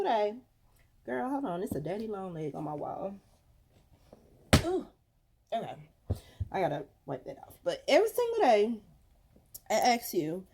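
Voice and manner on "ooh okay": ordinary talk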